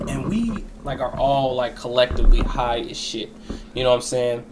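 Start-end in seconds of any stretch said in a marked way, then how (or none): none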